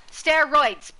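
loud, punchy exclamation; excited shout. Real, not acted. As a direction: bellow the word with glee. Emphatic repeat of word.

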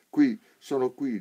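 risposta accorata, lenta, alta e forte; pianto. A narrator's reading. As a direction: rispondi chiamando, con un accenno di pianto. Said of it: "diceva lei piangendo".

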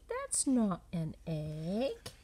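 plain reading voice

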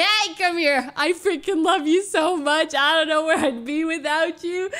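put-on loud, gushing drunk affection; hammed-up tipsy gushing; acting drunk. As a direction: declare love like a drunk friend. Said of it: "you're drunk and you're like".

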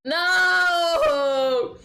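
high, loud wail of protest; dismayed shout. Real, not acted. Streamer watching a hawk grab the mouse, dismayed shout. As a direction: cry out in dismay.

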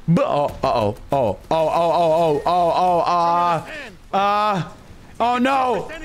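rising panicked oh-oh stammer; terrified gibbering. Real, not acted. Panics as the fight turns.

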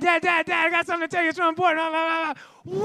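high-pitched kid badgering Dad; pestering child. Child interrupting Dad with important news, high and pestering. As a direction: rattle on insistently like an excited child.

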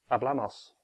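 hablamos at normal pace, the stress still on "bla"; said at natural speed. Shown as a demonstration. Teacher shows the stress holds even at normal speed. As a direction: say hablamos quickly but keep the "bla" stress. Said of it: "no matter how quickly you say it".

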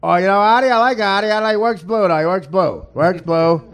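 high, excitable caricature of a radio host; Russo impression. Comic mimics a radio host's verdict on him, excitable impression. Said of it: "he sounded like Steve Allen".

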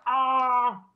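long, high, loud "aaah"; hammed-up howl of a squirming man; mock pain cry. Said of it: "he's, like, squirming on the ground".

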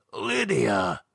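a hint of an old man's croak. A narrator's reading. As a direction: croak the name out. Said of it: "he croaked".

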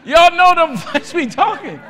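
loud, high laughing call; laughing shout. Real, not acted. Preacher shouts laughing to the church about his flesh.

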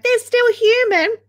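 high-pitched mimicking of a protester; mock objecting commenter. Streamer imagining someone up in the comments, mocking high voice.

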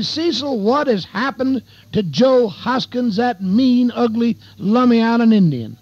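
high-pitched, astonished questioning; incredulous townspeople. Acted. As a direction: voice amazed locals demanding an explanation. Townspeople asking about a notorious man's change.

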